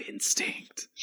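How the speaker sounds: hushed, voiceless delivery of one word; soft mock-dramatic whisper. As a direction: whisper it like an epic reveal. Acts out hero's dramatic one-word answer.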